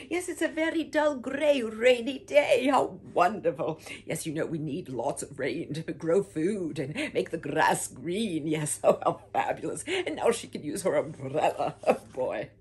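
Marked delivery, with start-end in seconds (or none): none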